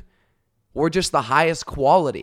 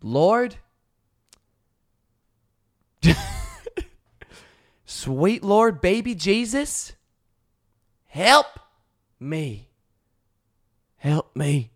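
mock prayer; 5 semitones higher, much slower than usual.

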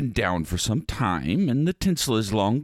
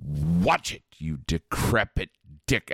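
low snap; 7 semitones lower than usual. Acted. Snapping at heckler.

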